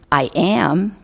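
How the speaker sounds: normal talking voice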